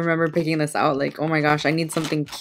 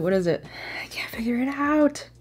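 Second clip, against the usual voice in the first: hushed, puzzled whisper to herself; 6 semitones higher, 5 dB quieter than usual. Real, not acted. To herself while sniffing the oil, a puzzled whisper. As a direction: breathe it out, baffled.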